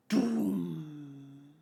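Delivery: low, drawn-out vocal boom; low mouth-made explosion; imitated boom. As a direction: imitate a deep blast, letting it ring. Imitates a blast in story.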